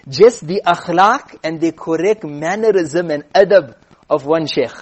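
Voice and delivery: ordinary talk, nothing marked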